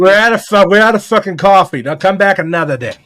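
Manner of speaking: a brash put-on Boston coffee-shop server; Boston accent. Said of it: "a Boston accent".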